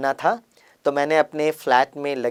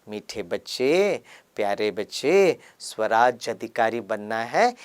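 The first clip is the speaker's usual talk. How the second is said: slow, soft and lower, like a gentle father; loving; 5 semitones lower, 3 dB quieter, slower than usual. Retold or imitated. Baba speaks tenderly to his children, slow and soft. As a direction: lovingly coax the dear children. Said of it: "बड़े प्यार से कहते".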